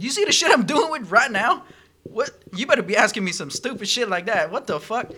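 imitating his own angry rant; mock angry rant. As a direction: vent at the manager, hammed up. Retold outburst at manager.

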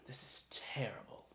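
hushed breathy "This is terrible." muttered to himself; whispered disgust. Acted. Lord inspecting the damaged amulet, whispering in disgust.

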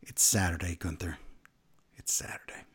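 very soft, low deadpan aside; hushed, exasperated correction; weary correction. Retold or imitated. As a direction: mutter the correction, fading to a whisper. Weary written correction of wrestler.

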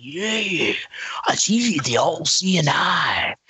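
slow, drawn-out crazed ranting; gibberish raving. Retold or imitated. Storyteller imitating Jasmine raving in the back seat, crazed gibberish. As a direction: imitate eerie raving, dragging out the vowels. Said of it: "It was kinda this, like, gibberish".

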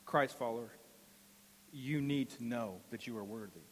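low, soft pleading sermon voice; soft earnest preaching. Acted. Pastor quietly pleads with the church.

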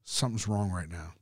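retold uneasy murmur. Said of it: "It's like".

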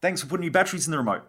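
wife gushing over new remote batteries, mock bright; mock chirpy wife. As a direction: play the delighted wife, rattling it off fast. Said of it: "Wife turns the T V on and goes".

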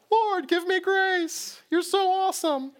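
high, slow, far-off whine as a petulant Jonah; whiny mocking Jonah. Jonah mocked, quoted by God.

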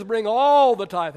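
"all" drawn out long and loud; stress on ALL. Acted. Pastor quotes God's command, "all" stretched loud. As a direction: lean hard and long on "all".

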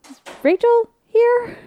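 high, slow, unsure little voice; timid high question. Retold or imitated. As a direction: ask it shyly, stretching it out. Timid question at wrong door.

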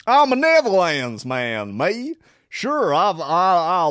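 put-on loud, high Dutchman mangling an American accent; hammed-up Hollander who learned English from John Wayne, in American twang; wonky Dutch-American accent. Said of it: "someone with a really wonky American accent".

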